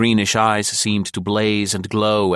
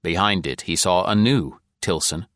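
ordinary delivery, nothing marked

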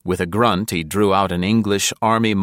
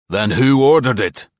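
sharp, louder, higher snap; snapped; 5 semitones higher, 6 dB louder than usual. A narrator's reading. Gruff captain snaps at Val, sharp and louder. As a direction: bite the question off hard. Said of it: "he snapped".

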